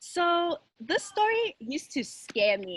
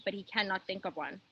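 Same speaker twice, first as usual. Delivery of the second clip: ordinary delivery, nothing marked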